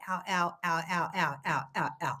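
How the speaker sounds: low, soft mock groans of pain; mock pained ows. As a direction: imitate a hurt husband groaning quietly. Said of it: "he's like".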